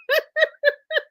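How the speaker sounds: short, high giggle; high laugh. Real, not acted. Host giggling at the viewer's joke, high laugh. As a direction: giggle helplessly.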